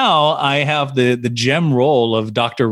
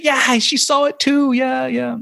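much higher, slower gushing dad voice; thrilled dad; 14 semitones higher, slower than usual. Retold or imitated. Dad on the phone confirming mom saw it, high gushing. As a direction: answer like a delighted dad. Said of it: "I said".

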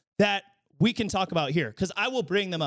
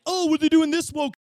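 higher, louder mocking friends; 5 semitones higher, 4 dB louder than usual. Friends fretting about woke content, a mock higher parody. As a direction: parody their outrage. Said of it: "They're all like".

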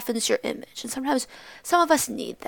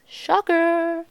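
higher, drawn-out "shocker"; mock surprise; 6 semitones higher than usual. Student commenting on the politician type, sarcastic. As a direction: toss it in as a sarcastic aside. Sarcastic aside about him.

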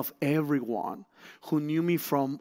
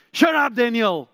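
higher, louder "shut up Daniel"; retold harsh snap; 8 semitones higher, 7 dB louder than usual. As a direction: bark it at him to silence him. Board member silences him suddenly.

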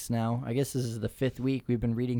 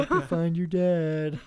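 high, slow, louder movie quote; Elf quote; 6 semitones higher, 4 dB louder than usual. Retold or imitated. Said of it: "the scene in Elf".

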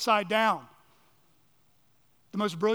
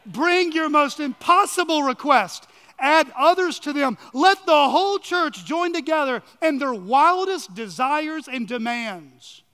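booming preacher; 4 semitones higher, 7 dB louder than usual. Acted. Preacher booming a quoted challenge.

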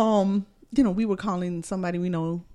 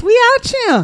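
high, loud, sassy slang delivery; much higher, loud, showy street slang; slangy catchphrase; 13 semitones higher, 10 dB louder than usual. Pretend. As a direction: proudly belt the catchphrase. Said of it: "you don't even talk like that".

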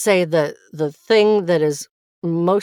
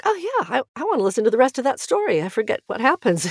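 pitched up and rushed, eagerly hooked on the story; eager inner voice; 7 semitones higher, faster than usual. Retold or imitated. Narrator replaying her own recording, thinking with eager delight. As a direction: sound delighted to hear more, fast and bright. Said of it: "and I'll think".

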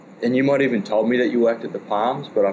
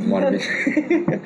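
much higher, slow, through laughter; laughing; much slower than usual. Real, not acted. Admitting to the friend that tattoo was misspelled, laughing, high and slow. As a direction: break up laughing at the mistake.

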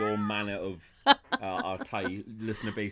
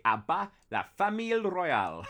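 higher French line; 9 semitones higher than usual. Shown as a demonstration. French, announced for Eileen. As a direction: proclaim it in French. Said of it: "en français, in French".

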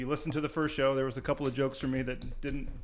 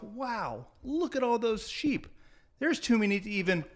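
amazed; 8 semitones higher, slower than usual. Retold or imitated. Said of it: "I thought to myself".

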